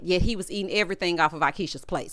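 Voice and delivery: usual speaking voice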